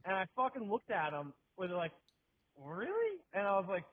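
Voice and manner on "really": high-pitched retold disbelief; incredulous. Retold incredulous look at beggar.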